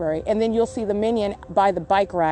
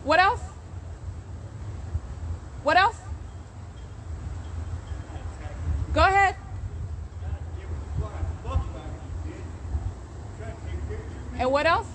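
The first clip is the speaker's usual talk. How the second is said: goading challenge; 3 semitones higher, 7 dB quieter than usual. Real, not acted. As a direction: keep prodding him to say more. Goading an invisible voice repeatedly.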